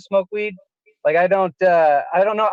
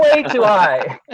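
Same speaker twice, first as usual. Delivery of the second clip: laughing; 3 semitones higher, 4 dB louder, slower than usual. Real, not acted. Laughing at own story.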